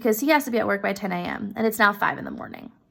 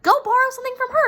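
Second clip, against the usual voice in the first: much higher and fast, rushing the idea out; eager suggestion; 14 semitones higher, 4 dB louder, much faster than usual. Retold or imitated. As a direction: blurt the solution in a high rush. Husband voiced in retold story.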